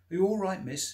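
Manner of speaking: a hesitant stranger calling out loudly; calling out into the dark. Acted. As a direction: call out to someone you can't see. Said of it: "aware how foolish the question sounded".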